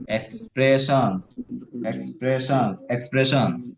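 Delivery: teacher showing how to build up speed on the word. Shown as a demonstration. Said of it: "তখন স্পিডটা বাড়ায়".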